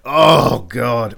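dismayed reaction. Retold or imitated. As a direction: let out a dismayed exclamation. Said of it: "and you're like".

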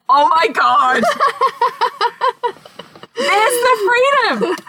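high, laughing and near tears as it dawns on her; overwhelmed, laughing relief; laughing realization. Real, not acted.

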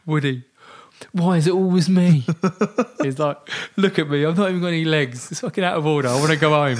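high, put-on whining voice; whiny complaint. Injured friend Jay moaning to Woody that he wants to go home. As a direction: whinge like you've had enough.

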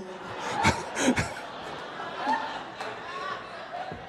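breathless, high-pitched wheeze of laughter; high silent laugh. Real, not acted. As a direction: laugh too hard to make a sound. Laughing at his story.

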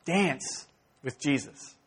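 soft, slow, sincere; earnest exhortation. Real, not acted. Preacher urging the congregation, soft and slow. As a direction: lay it down gently, slowly. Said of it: "That's my exhortation to you".

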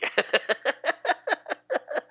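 high, rapid cackling laugh; orc laughing. Acted. Orc laughing at the coming fight, cackle. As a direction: cackle in a long high run. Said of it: "you hear the orc just laughing".

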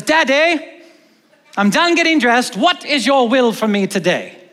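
put-on high, eager kid in a playful Old English accent; mock Old English accent. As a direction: squeak out Daddy in a hammy Old English accent. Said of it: "the accent's still there".

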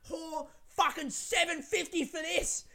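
slow, high-pitched imitated yell; mock yelling. Woman outraged at the wine's price, mock high yelling. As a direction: drag it out high, mimicking her rant. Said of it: "she was yelling".

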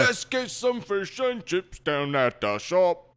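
slow, put-on British accent; mock British accent. Joining the bit with a slow mock British accent. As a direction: drawl it out like a British lad. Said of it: "Nailed his accent".

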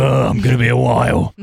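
lightly put-on gruff-bloke Scouser impression; rough mock impression of an oafish footballer; Wayne Rooney. Podcaster playing one of the oafs for laughs, mock oaf voice. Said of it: "It was just, like, Wayne Rooney".

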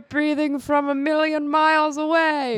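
high, taunting put-on voice; Haldir. Haldir mocks the dwarf's noise.

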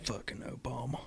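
very soft, whispered aside; barely voiced grumble; mock grumbling. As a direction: mutter it under your breath in mock blame. Mock grumbling aside echoing the joke.

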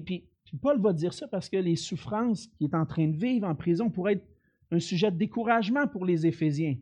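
usual speaking voice

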